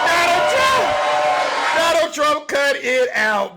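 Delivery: loud, high yell of his name; big comic telling-off; exasperated shout. Real, not acted. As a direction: holler at him, then stretch out the scolding. Calls out Trump after the clip.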